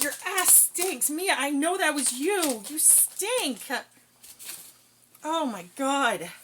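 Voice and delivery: loud, high, revolted scolding; disgusted scolding. Real, not acted. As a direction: yell at the stinky dogs. Scolding smelly dogs.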